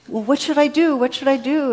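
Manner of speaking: high, loud, desperate pleading; panicked plea. Retold or imitated. Stranded hiker begs God what to do, high and panicked. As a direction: beg for instructions from above.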